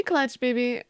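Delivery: normal talking voice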